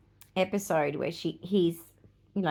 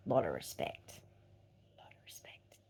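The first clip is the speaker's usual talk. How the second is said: soft then whispered repeat; fading to a whisper; 13 dB quieter than usual. Acted. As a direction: let it fade into a whisper. Repeats softly about hugs.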